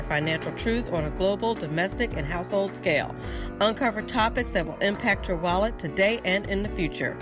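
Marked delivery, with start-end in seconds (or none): none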